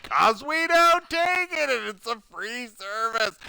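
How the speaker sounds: mock shouted reply; shouting back.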